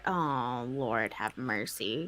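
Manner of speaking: slow, low Southern drawl; country drawl. Acted. Friend hears her slip into a slow country twang. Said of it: "Oh, she went country".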